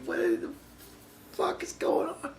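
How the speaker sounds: tiny, very high, soft voice of disbelief; mock bewilderment. Viewer who had no clue what they said, weakly bewildered. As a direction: squeak it quietly, utterly lost. Retold baffled reaction, after laughing.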